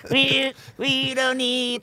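slow, high whiny rock singer voice. Retold or imitated. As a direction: whine it out like a nineties frontman. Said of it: "That's my [name] impression".

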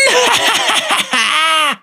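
hohes, lautes Schurkenlachen; böses Lachen. Acted. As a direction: diabolisch auflachen. Villain laughs. (böses Schurkengelächter)

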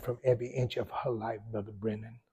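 ordinary talk

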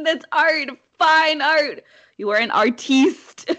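high, loud, theatrical insistence; mock-grand praise. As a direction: proclaim it like a pretentious art critic. Playful protest praising friend's drawing as art.

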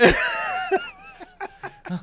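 loud high laugh; big burst of laughter. Real, not acted. Laughing at TikTok clip.

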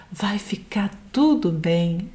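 quiet, slow comforting words; soft reassurance. Retold or imitated. Friend comforting an anxious person, soft and slow. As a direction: ease a worried friend gently. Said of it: "alguém fala assim pra gente".

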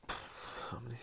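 quick whisper to himself; unvoiced under-the-breath question, as if counting; whispered aside. Real, not acted. Counting questions to himself, whispered. Muttering to himself mid-podcast.

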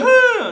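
sudden high loud laugh; burst of laughter. Real, not acted. Friend bursting out laughing at his cohost crying over the power, loud laugh. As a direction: crack up out loud.

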